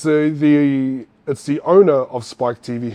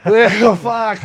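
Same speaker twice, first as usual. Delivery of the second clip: higher, louder rueful laughing curse; rueful laugh at his own bad luck; 8 semitones higher, 8 dB louder than usual. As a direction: laugh it out in disbelief.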